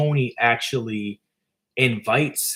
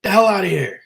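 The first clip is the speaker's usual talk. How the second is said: loud, high, outraged yell; angry shout; 8 semitones higher, 7 dB louder than usual. Retold or imitated. Pizzeria guy yelling the kid out of his shop, furious shout. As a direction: throw the kid out with a furious holler. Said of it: "He's like".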